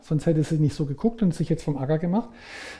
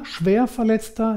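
ordinary talk

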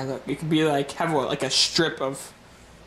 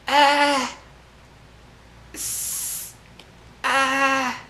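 high, slow hammed-up dying; overacted death scene; 6 semitones higher, slower than usual. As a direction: ham up a dying gasp. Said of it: "that dramatic enough?".

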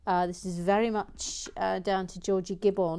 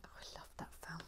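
whispering; 2 semitones lower, 20 dB quieter, much faster than usual. Real, not acted. Hushed aside.

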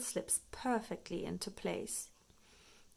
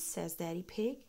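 ordinary delivery

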